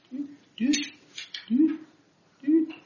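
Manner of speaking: high, spaced little "doo" noises; high button-pushing doo-doos. Real, not acted. Speaker clicking back through slides, high doo-doo noises.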